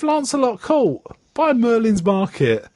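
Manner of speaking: high, sing-song address; quoted directions. Retold or imitated. Bill giving the address in a high sing-song. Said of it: "He goes".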